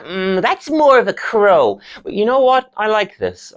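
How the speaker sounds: high-voiced lecturer, musing then approving; [name]. Lecturer judging student's bird call, high and musing. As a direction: warm to the idea theatrically.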